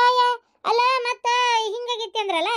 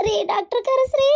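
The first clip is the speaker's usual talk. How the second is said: higher, pained call for the doctor; urgent pained plea; 5 semitones higher than usual. Acted. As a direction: beg for help as the pain worsens. Labour pain worsens, calls for help. (ನೋವಿನಲ್ಲಿ ಕೂಗಿ ಬೇಡುವುದು)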